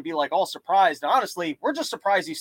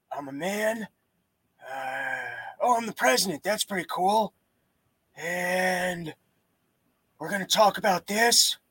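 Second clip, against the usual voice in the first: put-on a softer, half-asleep old president; Biden impression; 3 dB quieter, slower than usual. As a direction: mumble like you just woke up confused. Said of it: "Biden being able to wake up and start press conferences going".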